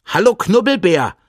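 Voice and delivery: high, bright-voiced call of a cheeky hare. A narrator's reading. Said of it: "ruft das Tier".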